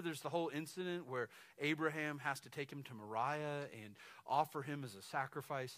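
ordinary talk

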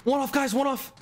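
high, eager call out; excited. Real, not acted. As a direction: hype up the chat. One kill from a nuke.

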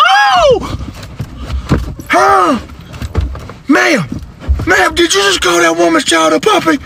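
very high, scandalized squeal; shocked disbelief. Acted. Bystander calling out an elderly lady, squealed shock. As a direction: gasp it out in high-pitched shock.

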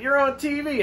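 very high-pitched mock insistence; retold excited fan. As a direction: imitate an amazed fan insisting.

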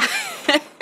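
high-pitched laugh; short squeal of laughter. Acted. Mocking the banana talk.

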